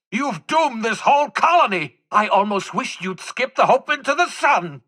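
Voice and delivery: high, sharp indignant rant; exasperated scolding. Acted. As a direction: scold them like an incompetent student. Scientist berates ally, exasperated.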